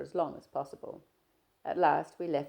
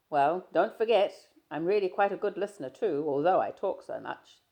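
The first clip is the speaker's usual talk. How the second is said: a louder, lightly voiced young man, breezy; Stephen, teasing; 3 semitones higher, 9 dB louder than usual. Stephen greeting his shy friend, easy and teasing. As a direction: play Stephen chatting easily, a touch louder.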